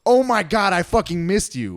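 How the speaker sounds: high, gushing imitation of a friend; gushing reunion. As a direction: mimic an over-the-top hello to someone missed. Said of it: "turn and go".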